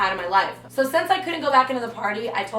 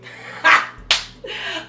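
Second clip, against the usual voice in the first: sarcastic laugh; 9 semitones higher than usual. Real, not acted. Scoffing at his excuse.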